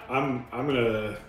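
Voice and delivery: ordinary talk, nothing marked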